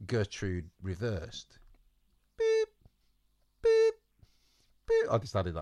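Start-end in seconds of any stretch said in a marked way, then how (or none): 2.4-5.1 high, loud electronic-style beep; reversing beep. Pretend. Narrator adds beeps for reversing goat, high beeping. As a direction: imitate a truck's reversing beeper. Imitates a reversing vehicle's beeper.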